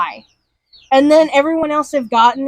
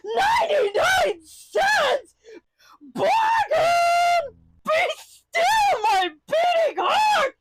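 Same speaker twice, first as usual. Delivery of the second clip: very high, slow, swooning shriek with a laugh in it; faint with delight at the bargain; cartoon dog nearly swoons over a ninety-nine cent deal; overjoyed dog; 7 dB quieter, much slower than usual. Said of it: "the dog turns around and goes".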